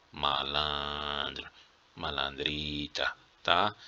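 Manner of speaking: slow, low, soft teasing reproach; mock scolding. Teacher to students who didn't pause, slow mock scolding. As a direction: draw it out like catching a little rascal.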